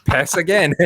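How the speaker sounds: blurted through real laughter; laughing. Real, not acted. Answering the lunch joke with his friend, laughing. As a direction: squeeze the words out mid-laugh.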